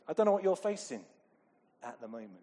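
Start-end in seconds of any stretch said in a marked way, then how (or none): none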